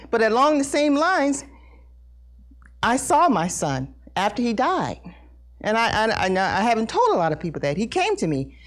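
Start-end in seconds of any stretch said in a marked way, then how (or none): none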